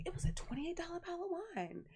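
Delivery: high, fast imitation of gushing aunt; mock excited boast. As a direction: repeat it high and quick, hamming up the boast. Acts aunt repeating the boast excitedly.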